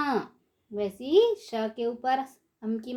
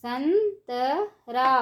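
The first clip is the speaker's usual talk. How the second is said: संतरा stretched slowly, syllable by syllable; slow syllable drill; much slower than usual. Shown as a demonstration. Teacher drawing out संतरा slowly for children. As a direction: draw out संतरा for the class. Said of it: "ऊंची आवाज़ में कैसे पढ़ेंगे".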